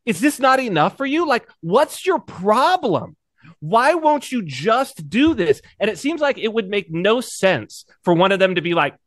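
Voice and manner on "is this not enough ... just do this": mock-pleading governor, much higher and slow; exasperated governor. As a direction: whine it like a fed-up Roman governor. Governor pressing saint after prison.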